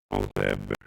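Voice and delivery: ordinary talk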